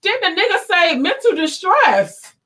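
outraged. Real, not acted. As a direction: voice climbing high with outrage. Heated argument about police shooting.

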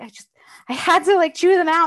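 high, loud fast grumbling; exasperated complaint. Retold or imitated. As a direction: complain loudly, a bit fast. Retelling venting to roommate.

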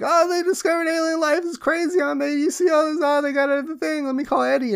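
very high hammed-up excited voice; excited Peter impression. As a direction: gush about alien life in a squeaky voice. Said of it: "Peter's like".

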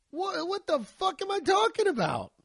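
real shock that time flew by; astonished outburst. Real, not acted. Shocked at how fast time passed.

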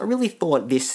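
normal talking voice

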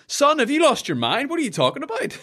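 high-pitched, disbelieving family outburst; exaggerated high-pitched bafflement; mock incredulous relative. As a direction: play an aghast parent questioning their child. Acted baffled family reaction to job news.

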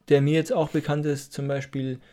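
ordinary talk, nothing marked

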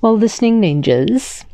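plain reading voice

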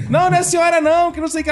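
high-pitched hasty denial, imitated; flustered daughter. Daughter reassuring her offended mother, imitated high protest. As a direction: play a daughter backpedalling to her mum. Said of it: "ela dizia que morria de dificuldade".

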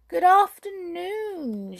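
high, slow, sugary witch voice; a witch drawing out her greeting. A narrator's reading. Old witch greets the brownies at the door, high and slow.

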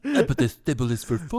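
put-on French accent. Mimicking French waitress.

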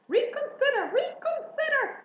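very high, loud plea; crying out. A narrator's reading. Goose begging pig to turn back, crying out. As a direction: squawk a last desperate appeal. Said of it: "cried the goose".

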